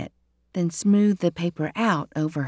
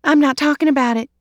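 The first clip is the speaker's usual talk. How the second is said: higher, louder, fast shutdown; sharp refusal; 7 semitones higher, 6 dB louder, faster than usual. Acted. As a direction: slam the topic closed. Emma Lou refuses to tell.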